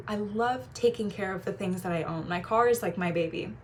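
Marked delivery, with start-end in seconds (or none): none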